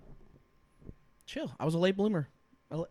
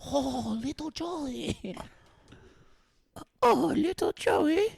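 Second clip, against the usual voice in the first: grandma voice; 6 semitones higher, much slower than usual. Retold or imitated. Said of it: "Joe said".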